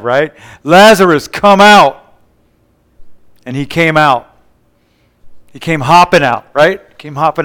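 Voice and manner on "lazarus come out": loud, higher-pitched commanding call; calling into the tomb. Retold or imitated. Preacher as Jesus calling dead Lazarus out, commanding call. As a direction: summon him out with a raised voice. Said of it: "Jesus called into the tomb".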